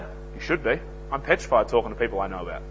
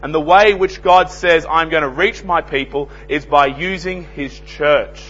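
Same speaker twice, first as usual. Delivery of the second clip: loud, high preaching, slowing on key words; fiery sermon delivery; full-force preaching; 6 semitones higher, 9 dB louder than usual. Acted. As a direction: thunder it out from the pulpit. Preacher building to loud climax.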